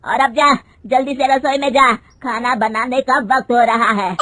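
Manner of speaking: mother-in-law scolding her daughter-in-law, loud and angry. Acted. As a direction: rebuke her sharply and loud. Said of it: "गुस्से में कहती है".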